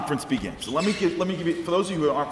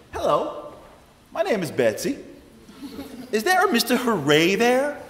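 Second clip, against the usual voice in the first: a high, sing-song Southern caller; high Southern lady; 9 semitones higher than usual. Retold or imitated. Caller from Georgia introduces herself, high sing-song Southern lady. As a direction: play the chirpy Georgia caller, pitched up.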